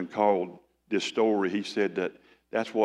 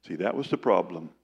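ordinary talk

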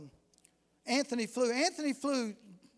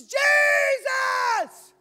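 long, much higher shouted Jesus; shouted cry to God; 14 semitones higher, 9 dB louder than usual. Retold or imitated. Drunk man in the church crying out to God, shouting. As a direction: cry out to heaven at full voice. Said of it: "he looks up one more time and he says".

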